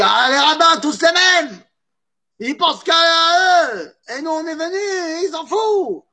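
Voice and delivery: high, shouted angry complaint about rabbins; furious rant. Retold or imitated. Frustrated student rants at rabbis, high furious shout. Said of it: "il se vénère".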